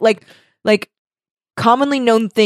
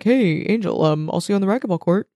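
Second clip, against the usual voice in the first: offhand, softer, hurried sign-off; casual guy; 4 dB quieter, faster than usual. Retold or imitated. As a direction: toss it off like an acquaintance passing by. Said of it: "a guy that's like".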